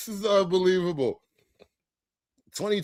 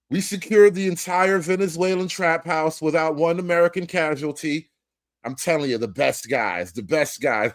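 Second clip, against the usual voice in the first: low, boastful Trump voice; low Trump impression; 4 semitones lower than usual. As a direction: brag like a president.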